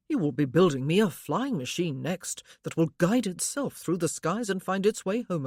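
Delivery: lightly voiced teasing husband; husband's voice. A narrator's reading. Husband teasing his inventive wife, lightly voiced.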